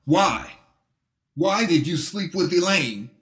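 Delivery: high, strained furious voice; losing his temper. A narrator's reading. Betrayed husband confronting his wife's lover, high and furious. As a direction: rage pouring out at his rival. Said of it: "now losing his temper".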